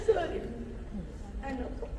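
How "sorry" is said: soft, tearful sorry; quietly choked up; teary apology. Real, not acted. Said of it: "mababa lang yung luha ko".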